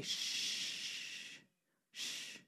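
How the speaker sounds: long soft soothing shush; a still small voice shushing; gentle hushing. Acted. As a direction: hush softly, drawn out. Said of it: "small voice to say".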